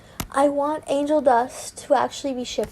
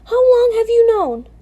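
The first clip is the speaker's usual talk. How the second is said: high, loud interrogation; angry demand; 9 semitones higher, 6 dB louder than usual. A narrator's reading. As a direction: demand an answer from your husband, heated. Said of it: "I spat".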